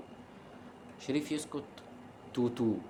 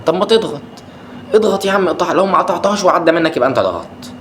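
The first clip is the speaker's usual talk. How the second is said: higher, louder urging at the players; urging shout; 6 semitones higher, 6 dB louder than usual. Real, not acted. Fan shouting at defenders who keep retreating, loud and urgent. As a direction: yell at them to press.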